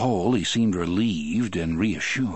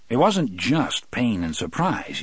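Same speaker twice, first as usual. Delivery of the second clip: plain reading voice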